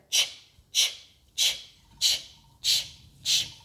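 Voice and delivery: unvoiced "ch" puffs in a steady chug; train chugging noise. Shown as a demonstration. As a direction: imitate a steam train with repeated "ch". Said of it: "can you say the ch sound as we drive it around".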